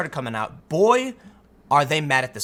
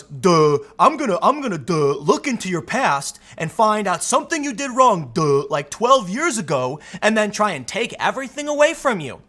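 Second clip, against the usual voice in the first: dopey impression; 3 semitones higher than usual. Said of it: "He says he's gonna do an impression".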